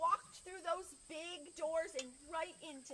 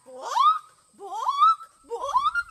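very loud squawks climbing higher; clucking chicken; 20 dB louder, much slower than usual. Acted. Chicken asks the librarian for books, clucking loudly. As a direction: cluck the word like a hen. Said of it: "said Chicken".

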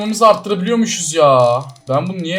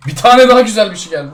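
higher, louder, fast happy call-out; excited cheer; 6 semitones higher, 9 dB louder, faster than usual. Real, not acted. Streamer cheering another drop for viewers, louder and quick. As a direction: burst out at yet another good drop.

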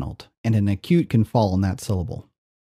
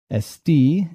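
Esti with the last syllable raised; final-syllable stress; 5 semitones higher than usual. Shown as a demonstration. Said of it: "I am accenting them on their final syllable".

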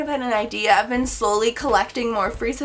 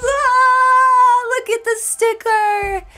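excited squeal; 11 semitones higher, 6 dB louder, much slower than usual. Real, not acted. Squealing over a sticker.

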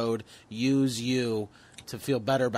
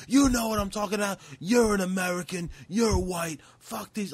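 higher, sneering mock bigot; 6 semitones higher than usual. Ranter trying to recruit a big bald customer, higher mock sneer. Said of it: "and he's like".

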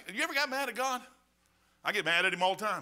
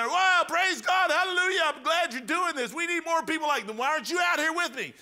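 enthusiastic supporter; 7 semitones higher, 4 dB louder than usual. Retold or imitated. Street preacher voicing an admiring passerby, gushing. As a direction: gush like a thrilled churchgoer.

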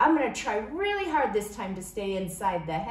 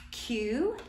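ordinary talk, nothing marked

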